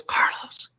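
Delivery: barely-there whispered call; lost-voice whisper. Retold or imitated. Singer phoning her bandleader, voiceless whisper. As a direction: imitate a singer with no voice, all whisper. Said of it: "I lost my voice".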